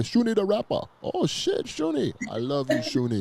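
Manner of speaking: high, excited mock African accent fans shouting a name; hammed-up African accent, way up in pitch; bad African accent. Host mimics African fans greeting the rapper in a bad African accent. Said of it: "That's a horrible, um, uh, African accent".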